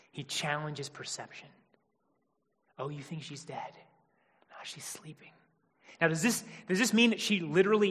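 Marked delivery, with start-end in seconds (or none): 2.8-5.3 gentle hush. Retold or imitated. Voicing Jesus challenging the mourners.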